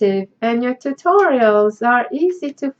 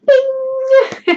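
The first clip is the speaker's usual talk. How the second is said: mock bing sound; 12 semitones higher than usual. Sound effect while opening parcel.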